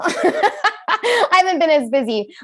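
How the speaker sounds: giggling self-mocking repeat; laughing repeat. Shown as a demonstration. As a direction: crack up while saying it again. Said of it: "Can you tell?".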